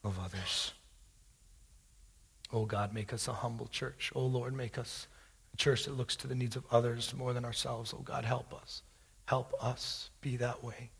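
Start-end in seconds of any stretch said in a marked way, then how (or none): 2.5-10.9 hushed earnest prayer to God. Acted. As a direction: pray it gently, asking humbly. Closing prayer.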